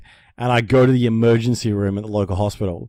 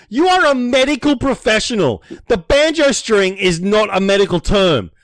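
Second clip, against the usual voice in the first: slow, much higher, louder disbelief; indignant outburst; 9 semitones higher, 4 dB louder than usual. Retold or imitated. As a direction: tell the doctor off, outraged. Indignant rant at the doctor.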